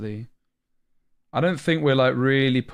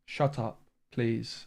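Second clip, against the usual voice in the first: softer, deflated "Shut up, please"; subdued; 7 dB quieter, much slower than usual. Real, not acted. Streamer calming down after snapping at chat, subdued. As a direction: drop it to a tired, quiet "Shut up" and "please". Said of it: "That's actually triggered me".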